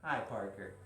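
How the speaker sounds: low, soft final hello; low-pitched greeting. Real, not acted. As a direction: drop down low and soft on Parker. Last of three Parker greetings.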